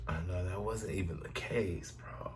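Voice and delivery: fading to a whisper; quiet aside. Real, not acted. Cohost muttering an aside to her partner. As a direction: mutter it under your breath.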